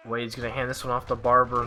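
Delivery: usual speaking voice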